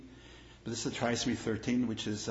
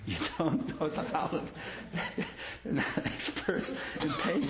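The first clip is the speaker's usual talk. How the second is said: laughing; 5 semitones higher than usual. Real, not acted. Rabbi laughingly repeats the joke about Holland. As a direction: repeat it higher while chuckling.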